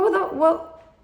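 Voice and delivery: high, disbelieving what the what; stunned double take; realising she's in a room of older wives, shocked. Retold or imitated. Shock at AA meeting.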